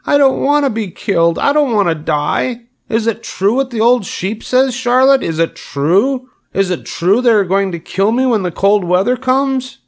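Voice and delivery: very high, pleading piglet wail; panicked scream. A narrator's reading. Wilbur begs Charlotte for the truth, panicked squealing. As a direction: squeal each question in desperation. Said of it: "screamed Wilbur".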